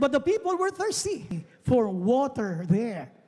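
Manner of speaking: slow, measured Bible reading; scripture reading. Acted. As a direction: read the verse slowly and deliberately. Reads scripture verse.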